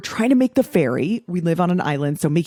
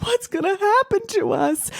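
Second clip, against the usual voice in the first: much higher, childlike mock fear; imitating scared son; 13 semitones higher than usual. Mother imitating younger son Buddy's fearful whimper. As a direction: squeak it like a worried little boy. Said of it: "my son Buddy would be like".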